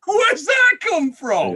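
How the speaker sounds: high, slow, astonished squeal; amazed disbelief. Retold or imitated. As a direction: stretch it out in shocked wonder. Amazed retelling of the singing.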